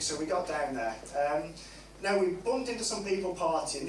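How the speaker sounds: ordinary delivery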